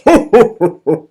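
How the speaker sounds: loud jolly ho-ho-ho laugh; Santa ho ho ho. Shown as a demonstration. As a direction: belly laugh like Father Christmas. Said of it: "It goes".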